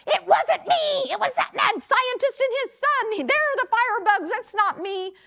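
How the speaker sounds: high-pitched, hammed-up furious protest; mock the screaming, cornered woman; screaming mad. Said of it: "She was screaming mad".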